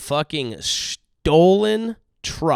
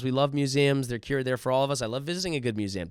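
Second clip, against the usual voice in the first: ordinary talk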